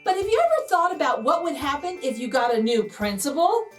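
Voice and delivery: ordinary delivery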